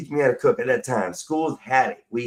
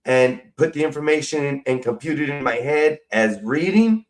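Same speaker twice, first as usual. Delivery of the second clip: ordinary delivery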